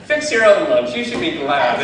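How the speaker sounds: loud, laughing snap back; mock retort. Wife to Pastor Wayne at lunch, loud laughing retort. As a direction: shoot it back at him.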